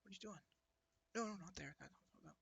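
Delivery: very soft, distant background murmur; barely audible fussing from across the room; small, faint voice of someone muttering objections; faint little voice. Acted. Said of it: "just faint little voice going".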